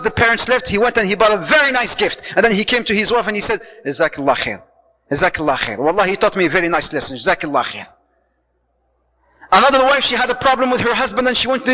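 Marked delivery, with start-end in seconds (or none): 3.9-7.9 grateful thanks. Retold or imitated. Husband thanking his wife.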